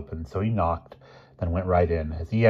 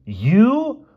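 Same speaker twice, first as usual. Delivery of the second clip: high-pitched startled "you"; high, loud disbelief; 7 dB louder than usual. Acted. Boy reacting to his friend, high and startled.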